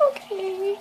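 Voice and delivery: meek okay. Retold or imitated. Flustered fan answers Ricky, tiny high meek voice. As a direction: give up in a small high voice. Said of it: "I'm like".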